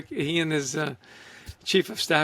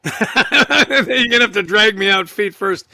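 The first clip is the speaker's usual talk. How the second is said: laughing; 3 semitones higher, 8 dB louder than usual. Real, not acted. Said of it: "laughing at own joke".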